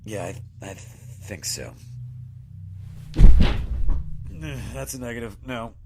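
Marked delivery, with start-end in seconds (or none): 1.8-4.7 loud strained exhale of pain; a loud hurt sigh on failing to stand; pained heavy groan. Acted. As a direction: groan as the wound pulls. Trying to stand, injured.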